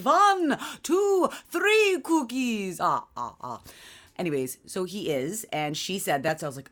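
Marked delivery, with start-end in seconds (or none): 0.0-3.6 high, slow Count counting voice. Retold or imitated. Mom doing the Count to describe her son, high and slow. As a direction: ham up the vampire counting. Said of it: "He's just like the Count from Sesame Street".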